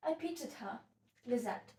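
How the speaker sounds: heightened stage-heroine finish; Sylvia, resolute then pleading. Acted. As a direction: refuse that fate, then appeal to a confidante. Sylvia resolves not to become her.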